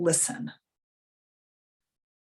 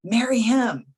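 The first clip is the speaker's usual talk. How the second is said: emphatic, higher and louder command; raised, insistent quoted order; insistent inner voice; 7 semitones higher, 6 dB louder than usual. Retold or imitated. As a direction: urge it like an inner voice. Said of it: "same voice that told me".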